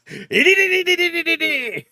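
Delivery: high laughing "dididi" noise; giggling rapid-fire noises; laughing imitation of fight noises.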